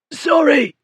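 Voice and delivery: high, strained gasp; choked, high-pitched apology. A narrator's reading. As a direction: gasp it out after being throttled. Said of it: "Bullard gasped".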